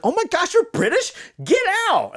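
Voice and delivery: high-pitched mock astonishment; squeal-pitched comic surprise; amazed host. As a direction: imitate a talk-show host's disbelief, way up high. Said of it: "the host is like".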